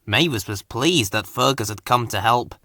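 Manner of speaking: ordinary delivery, nothing marked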